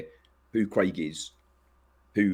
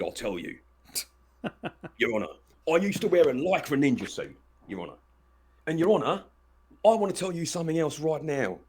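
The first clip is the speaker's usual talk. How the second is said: mocking; 7 semitones higher than usual. Mock testimony to a judge.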